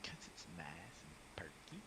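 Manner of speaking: breathy teasing whisper. Pretend. Teasing the word perky.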